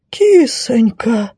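high, slow moan of shock; stunned knight's drawn-out whine. Acted. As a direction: moan it out, long and pitiful. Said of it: "потрясённо простонал рыцарь".

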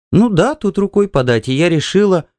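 high, eager woman's voice; hurried wife. A narrator's reading. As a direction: justify the outing, pitched well up. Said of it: "Она очень торопилась". (высокий голос жены, торопливо)

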